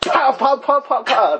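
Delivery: rapid high-pitched pops; shrieky mock gunfire, fast and even; imitated gunshots. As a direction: fire off a quick volley with the voice.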